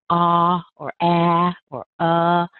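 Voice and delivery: "ah or ah or uh" with each vowel long and full; contrasting vowels drawn out one by one; full vowel sounds. Shown as a demonstration. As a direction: stretch out "ah", "ah", "uh" as loud full vowels. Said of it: "any of the regular vowel sounds".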